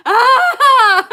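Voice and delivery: high loud mock shriek; mock scream. Imitating her own reaction to the dragon's snow jump, a shriek. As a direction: squeal like jumping in icy snow. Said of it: "And I'm like".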